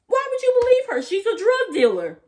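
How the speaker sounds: high, loud, shrill-sounding panic; Cassie's outburst. Retold or imitated. Vlogger mimicking Cassie's panicked outburst, high and loud. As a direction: scream it defensively.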